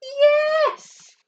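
high, loud yes; excited cheer. Real, not acted. Teacher cheers the children's correct answer.

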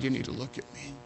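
a pastor gently pleading at the door; soft urging. Retold or imitated. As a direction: coax her quietly to look up. Preacher urging girl at door.

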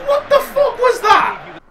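very high, incredulous cry; very high incredulous outburst at the game; exasperated outburst. Real, not acted. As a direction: squeal the question in disbelief. Raging at pass to goalkeeper.

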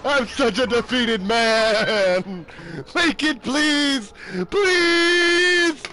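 slow, high hammed-up wail; mock-tearful pleading. Defeated gamer begging to make it, slow mock-crying plea. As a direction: beg like a broken man.

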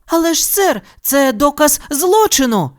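high, flustered protest; protest, thrown off balance; flustered Judy. A narrator's reading. Judy protests to Milton, confused and flustered. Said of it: "розгубилася Джуді".

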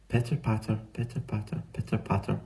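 hushed, rhythmic rain patter; soft patter. A narrator's reading. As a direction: tap the words out very softly, like rain. Narrator voices soft rain sound.

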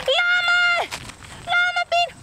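high, loud shout for a lost pet; calling out. Real, not acted. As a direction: holler the pet's name into the woods.

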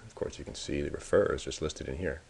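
ordinary delivery

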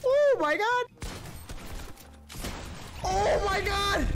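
high, drawn-out cry; alarmed exclamation. Real, not acted. Player down to the last flopper, high drawn-out cry. Tense fight with last healing.

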